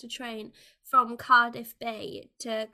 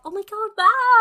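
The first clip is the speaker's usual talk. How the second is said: high, loud mock amazement; amazed; 10 semitones higher, 8 dB louder than usual. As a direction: gush like you're dazzled. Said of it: "people are like".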